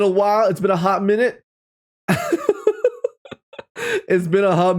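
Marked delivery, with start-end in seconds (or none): 2.1-3.1 squeaky burst of laughter; high laugh. Real, not acted. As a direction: giggle at the chat. Laughs reading chat.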